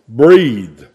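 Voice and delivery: ordinary talk, nothing marked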